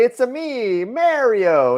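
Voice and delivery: high, loud video game Mario voice; Mario impression. S1 mocking the game hero's catchphrase in a high Mario voice. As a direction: play the plumber, big and bouncy. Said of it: "He doesn't say".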